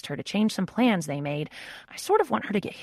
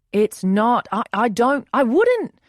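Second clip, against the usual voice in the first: flustered denial; 7 semitones higher, 11 dB louder, slower than usual. A narrator's reading. Oliver flustered denying a date.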